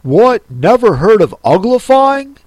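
loud, high Gryphon outburst; astonished exclamation. A narrator's reading. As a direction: burst out in disbelief. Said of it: "it exclaimed".

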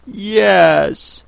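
drawn-out high yes; mild shout. A narrator's reading. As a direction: call it out without full force. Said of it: "shouted Alice".

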